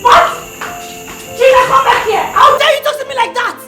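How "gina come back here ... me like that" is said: high, heated telling-off; angry scolding. Acted. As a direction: lay into her in an indignant, raised voice. Acted quarrel, scolding Gina in her house.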